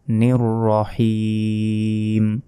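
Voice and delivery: slow, drawn-out chanted tilawat; Quranic recitation. Shown as a demonstration. Teacher demonstrating recitation to viewers, slow melodic chant. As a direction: recite it in long melodic tajweed.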